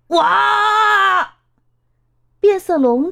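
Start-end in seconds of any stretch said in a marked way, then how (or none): 0.1-1.3 high startled wow as he slips; sudden high cry of alarm; surprised cry. A narrator's reading. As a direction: yelp of surprise mid-fall. Slips on banana peel.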